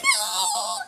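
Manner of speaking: very high squealed tantrum cry; mock crying scream. Little boy sent into the house, mock screaming cry. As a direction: imitate a little boy wailing in protest. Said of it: "So he goes in the house crying, screaming".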